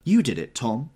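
loud, high accusation; accusing. A narrator's reading. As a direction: point the finger at Tom. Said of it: "she said accusingly".

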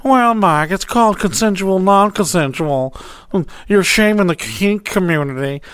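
high, prissy imitation of a lecturer; mocking scold. Mocking the kink community scolding him, high prissy voice. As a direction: mimic a preachy critic, pitched well up. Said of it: "trying to shame me".

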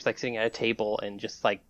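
usual speaking voice

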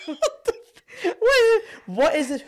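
high, slow words through laughter; laughing hard. Real, not acted. Laughing at the surgeon calling himself Michelangelo, real laughter. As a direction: barely get it out for laughing.

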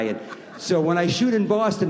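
ordinary talk

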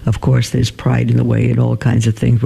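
ordinary delivery

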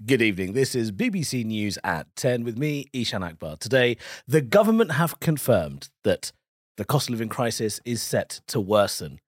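measured RP news bulletin read; formal broadcast delivery; newsreader voice. Shown as a demonstration. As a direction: read the evening headlines in received pronunciation. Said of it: "a newsread".

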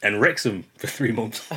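usual speaking voice

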